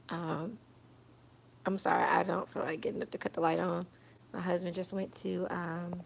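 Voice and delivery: worn-out voice, barely wanting to talk; weak after surgery. Real, not acted. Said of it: "I barely feel like talking right now".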